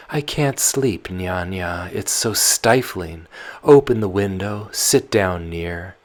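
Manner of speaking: put-on loud, restless girl pleading with her nurse; Tatiana, sleepless and pleading; young Tatiana.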